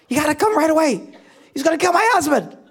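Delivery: high, loud mock-panicked phone plea; freaked-out wife. Wife calling the police to save her husband, freaked out. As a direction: imitate a frantic caller begging for help. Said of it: "she freaks out. So she calls the police".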